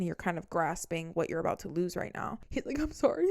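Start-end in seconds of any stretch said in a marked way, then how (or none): 2.8-3.3 high, wailing sob; mock sobbing apology. The ex apologising to her, mock sobbing. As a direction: blubber the apology out. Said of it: "He was crying".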